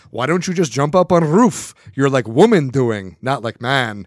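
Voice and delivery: mock the Russian neighbor in a thick Russian accent. Neighbor taunting him from the yard, in a thick Russian accent. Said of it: "in his very thick Russian accent".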